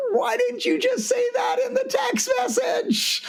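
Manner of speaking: very high, slow, shouted disbelief; exasperated outburst. Retold or imitated. An employee snapping at her boss after days of worry, in an exasperated shout. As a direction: throw up your hands and yell it. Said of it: "she's like".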